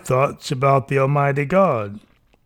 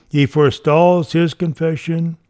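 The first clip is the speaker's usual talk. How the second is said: ordinary talk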